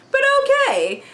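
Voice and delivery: sarcastic. Real, not acted. Sarcastic dismissal.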